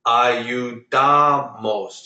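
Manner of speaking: Ayudamos very slow, syllable by syllable, DA stressed hard; second-to-last stress. Shown as a demonstration. Teacher demonstrating Ayudamos, very slow with a drawn-out stressed DA. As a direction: stretch Ayudamos and hit a-yu-DA-mos. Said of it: "Do you hear how I say".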